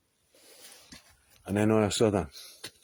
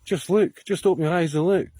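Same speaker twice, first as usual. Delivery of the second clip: high, loud urging voice; an imitated inner voice, pitched up and pressing; voice in head; 7 semitones higher, 5 dB louder than usual. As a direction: insist from inside someone's head. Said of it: "voice came again".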